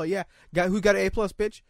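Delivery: normal talking voice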